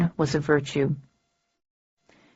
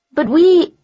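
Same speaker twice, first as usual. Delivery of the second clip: high, loud cut-off protest; girl protesting; 10 semitones higher, 6 dB louder than usual. A narrator's reading. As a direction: start to object in a high girl's voice. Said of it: "she said".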